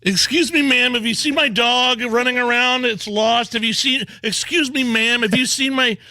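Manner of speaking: high, sing-song mock of a harmless stranger; mocking a frantic woman. Host mocking the innocent stranger, high and sing-song. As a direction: pitch it way up like a silly sweet busybody. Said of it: "Like, what is she talking about?".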